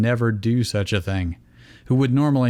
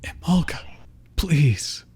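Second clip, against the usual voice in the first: whispered plea; 5 semitones higher than usual. A narrator's reading. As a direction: breathe the plea without voice. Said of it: "Iris whispered".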